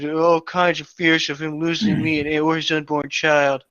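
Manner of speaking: normal talking voice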